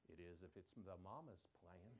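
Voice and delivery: low, soft knowing comeback; dry mama retort. Retold or imitated. Mother quietly overruling her son's protest. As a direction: settle it like a mother.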